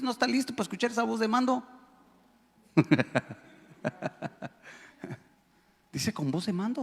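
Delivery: ordinary talk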